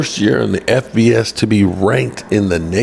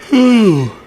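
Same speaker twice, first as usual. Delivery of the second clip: long tired yawn; 11 semitones higher than usual. Real, not acted. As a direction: let out a big sleepy yawn. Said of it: "I don't need to keep yawning".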